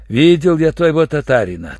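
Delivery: usual speaking voice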